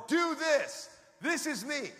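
mildly shouted instruction; imitated shout. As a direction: call it out emphatically, as a divine voice. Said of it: "Holy Spirit shouted into me".

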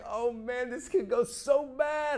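anxious, drawn-out worry; nervous dread. Retold or imitated. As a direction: fret like someone about to be judged. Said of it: "tremendous fear".

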